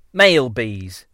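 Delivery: usual speaking voice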